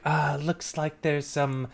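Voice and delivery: slow, drawn-out rummaging dad; lightly voiced dad hunting for food; father character. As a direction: take your time looking over the leftovers. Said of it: "and he's like".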